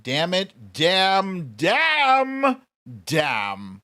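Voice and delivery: escalating mock frustration on each damn; exasperated repeated damns; frustrated damn. Real, not acted. Frustrated at viewers guessing right.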